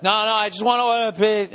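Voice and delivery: high, pleading rush; flustered caller begging to order; frantic protest. Retold or imitated. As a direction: cut in desperate before the hold. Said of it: "And as I was like".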